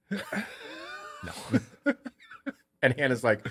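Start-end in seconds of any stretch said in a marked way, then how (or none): 0.1-1.7 giggled, breathy denial; laughing. Retold or imitated. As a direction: laugh it through guiltily. Kid laughing guiltily.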